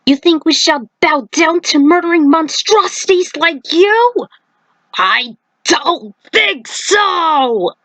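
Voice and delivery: high, rising defiance, last words slow; defiant outburst. Acted. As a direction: throw it back at the monster. Johnny defies the monster.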